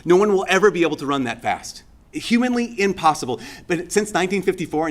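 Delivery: usual speaking voice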